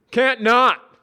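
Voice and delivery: high, loud jeer; mock him loudly. Scott jeering.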